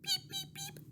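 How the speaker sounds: put-on baby chick cheeps; chick peeps. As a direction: peep like a tiny chick. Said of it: "that's what they say".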